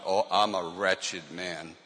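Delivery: usual speaking voice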